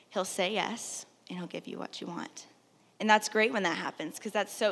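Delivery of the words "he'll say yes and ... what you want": soft hushed reading; whispered. Real, not acted. Preacher reads a post about God's answers, whispering.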